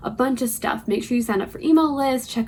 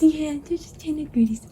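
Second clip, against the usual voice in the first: high, breathless whisper to a dog; whispered puppy voice; 6 semitones higher than usual. Acted. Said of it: "Sorry for my puppy voice".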